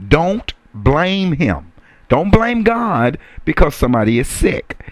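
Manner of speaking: ordinary delivery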